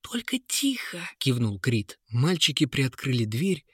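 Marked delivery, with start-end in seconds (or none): 0.0-1.0 put-on hushed urgent boy. A narrator's reading. Boys sneaking out unseen.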